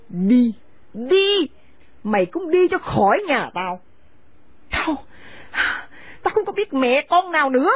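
harsh, contemptuous scolding of a daughter-in-law; bitter older woman driving her son's wife from the house; angry scolding mother. Acted. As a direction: spit the insults at her and order her out. Mother turns on her son.

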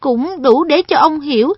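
put-on a polite boy guiding an elder; earnest boy voice. Perrin explains earnestly.

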